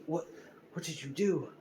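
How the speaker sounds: startled villain. Retold or imitated. Villain stunned after being splatted.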